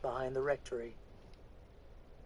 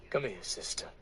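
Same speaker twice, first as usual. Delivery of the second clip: tender; 5 semitones lower than usual. Acted. Preacher embracing the weeping woman, low and tender. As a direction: pull her in gently.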